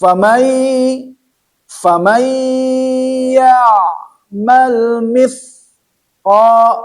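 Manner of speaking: high, drawn-out chanted Quran verse; Quran recitation. Shown as a demonstration. Teacher chants the verse for the student in Quran recitation style. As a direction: chant the verse slowly, melodically. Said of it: "Quran verse recited".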